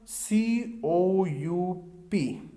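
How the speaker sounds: "सीओयूपी" spelled slowly, letter by letter; spelling out letters. Shown as a demonstration. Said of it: "इसकी spelling देख लीजिएगा". ("सीओयूपी" धीरे-धीरे अक्षर-अक्षर बोलना)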